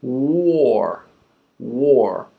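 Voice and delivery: War said alone, glided. Shown as a demonstration. Teacher adding the W, smooth. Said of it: "The W is called a glide".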